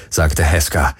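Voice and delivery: ordinary delivery, nothing marked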